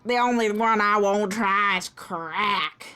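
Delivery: slow, sneering little gremlin. Shown as a demonstration. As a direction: drag it out like a giggly imp. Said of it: "I wish I gave him a little bit more of a gremlin voice".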